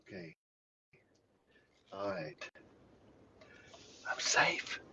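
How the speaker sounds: breathy whisper under his breath; hushed and relieved; whispered relief. Real, not acted. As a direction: whisper it so the dog won't hear. Sneaking past hidden dog.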